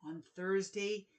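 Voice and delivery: put-on lively picture book reading for children; animated children's book narration; storybook read-aloud. A narrator's reading. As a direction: read it like story time with kids.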